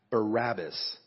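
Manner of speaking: usual speaking voice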